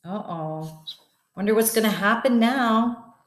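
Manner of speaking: ordinary delivery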